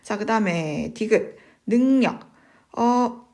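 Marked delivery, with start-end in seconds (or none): 1.6-2.3 능력 said as 능녁, higher and louder; nasal n replacing the ㄹ in 능력; correct pronunciation. Shown as a demonstration. Teacher reading out an item for students, 능력 projected as 능녁. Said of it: "맞는 발음입니다".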